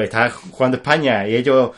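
normal talking voice

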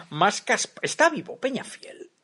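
ordinary delivery

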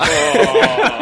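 loud, high-pitched "oh!"; real burst of amazement; delighted reaction. Real, not acted. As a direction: whoop as the joke lands. Hearing friend's pun land.

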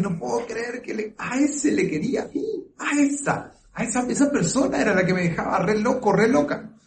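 high, fast voice of amused disbelief, mimicking a future self; incredulous mock disbelief. Future self recalling an ex, high amused disbelief. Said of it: "vas a decir".

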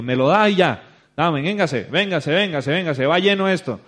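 loud, insistent playful herding; aggressive hustle, retold. Organiser collecting money to fill the bus, aggressively. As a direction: hustle them aboard like a pushy ticket seller. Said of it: "Uy, qué agresivo".

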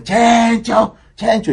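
high, sing-song mock shout of a nickname; taunting yell. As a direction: kids jeering from the street corner. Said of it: "gritándome".

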